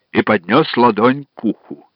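ordinary delivery, nothing marked

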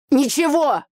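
high shout through a door. A narrator's reading. Brother shuts out his sister, shouting. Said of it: "выкрикнул Митя за дверью".

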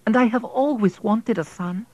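put-on a wife pleading for the boy.

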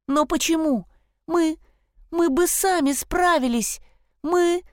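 put-on high, pleading girl's voice; upset girl protesting. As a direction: protest in a rush, voice climbing. Chloe protests to the stag.

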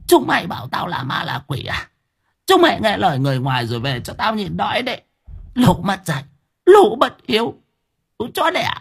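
high, rising shriek of curses; cursing hag. Acted.

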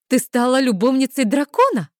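high, loud astonished question; pry with burning curiosity, voice lifted; eager, nosy exclamation; avid curiosity. A narrator's reading. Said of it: "загорелись глаза от любопытства и неприкрытой".

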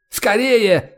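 high, urgent cry; yelled urging. A narrator's reading. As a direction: yell it in urgent haste. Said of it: "завопил Грег".

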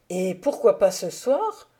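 a touch of eager impatience; impatient. Acted. Narrator urging the count to tell it tonight, mildly impatient. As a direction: push for it now, mildly impatient. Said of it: "dans mon impatience".

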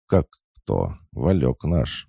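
ordinary delivery, nothing marked

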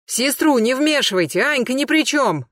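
high defensive shout. A narrator's reading. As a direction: shout to protect his sister. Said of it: "крикнул Астахов". (крикнул, защищая сестру)

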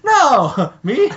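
high, loud fake-innocent denial; squeaky feigned innocence; mock innocent squeak. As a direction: play it like you'd never dream of it. Rainbow Dash feigns innocence.

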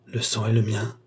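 plain reading voice

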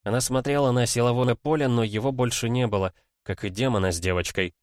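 ordinary delivery

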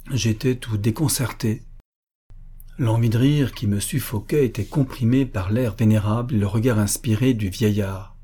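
plain reading voice

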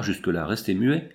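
ordinary delivery, nothing marked

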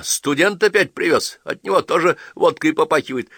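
high, loud sniping; suspicious grumbling wife. A narrator's reading. Wife complaining the guest smells of vodka, high and loud. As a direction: sneer at the guest.

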